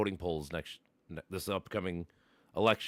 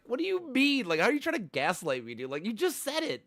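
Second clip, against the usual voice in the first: much higher and louder, exasperated; retelling the comeback in a much higher exasperated voice; incredulous outburst; 13 semitones higher, 5 dB louder than usual. Retold or imitated. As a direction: squeak out your disbelief at being gaslit. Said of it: "I'm like".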